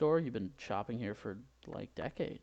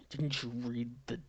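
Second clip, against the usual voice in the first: heated rebuke; 3 semitones higher, slower than usual. Acted. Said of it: "I'm getting too heated".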